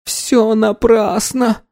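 high, slow anguished wail; despairing cry. Acted. Anna crying out as missiles approach, high despairing wail.

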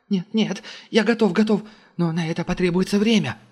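high, rushed frightened plea; panicked pleading. A narrator's reading. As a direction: beg for your life. Technician panics under threat.

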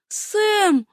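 very high, shocked call; gasped cry. A narrator's reading. Horrified young woman calls her lover, high gasp. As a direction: gasp the name high in dismay. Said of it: "ахнула она".

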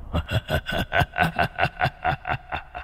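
whispered sinister laugh; breathy demon cackle. A narrator's reading. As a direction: hiss out an evil laugh. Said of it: "Bọn quỷ cười lên sằng sặc ghê rợn".

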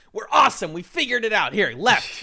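high, loud team celebration; mock triumph. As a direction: crow like a team that cracked it. Acting out teams' triumphant montage.

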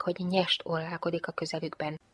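usual speaking voice

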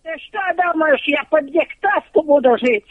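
normal talking voice

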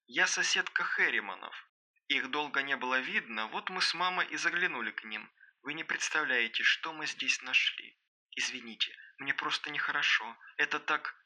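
put-on very soft, faltering young woman; distressed phone voice. A narrator's reading. Соседка рассказывает Аманде о страшной находке, hushed distress. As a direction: speak faintly, as down a phone line. Upset girl on far phone end.

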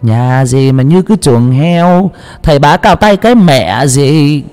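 slow, high, contemptuous jeer; scornful sneer. A narrator's reading. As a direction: look down on the shabby house. Rich woman sneers at hut.

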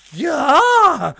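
high, loud broken cry; cracked voice. A narrator's reading. Осип accused, repeating in a cracked shout. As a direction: shriek it as the voice gives way. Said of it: "сорвавшимся голосом повторил".

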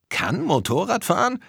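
can't believe what he just saw; boy staggered by the teacher on the motorbike; stunned disbelief. A narrator's reading. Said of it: "meinte Tom fassungslos".

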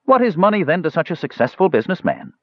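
high-pitched, gushing flattery; hurrying on. A narrator's reading. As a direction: talk him over before he can answer. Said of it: "He hurried on".

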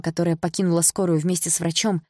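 usual speaking voice

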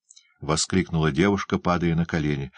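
ordinary delivery, nothing marked

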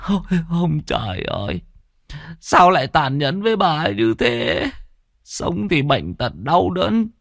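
high, drawn-out tearful lament; wailing grief. Acted. Old widower mourns his dead wife's suffering soul, wailing. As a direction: wail slowly, voice pitched high with sobbing grief.

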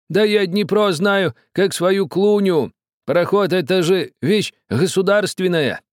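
high, slow proud boast; boastful old man. A narrator's reading. Old man boasting to the captain. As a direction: brag about knowing the river.

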